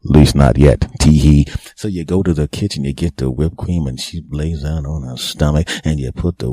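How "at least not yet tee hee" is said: louder naughty aside, tee hee; loud cheeky aside. Real, not acted. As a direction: punch the dirty joke loud, then giggle. Naughty joke with giggle.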